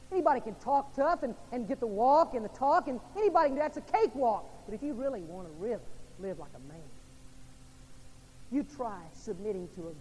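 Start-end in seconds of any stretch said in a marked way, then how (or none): 0.1-4.5 loud, high pulpit voice; fiery sermon climb; preaching at full force. Acted. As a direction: drive the point home hard. Preacher mocking macho posturing.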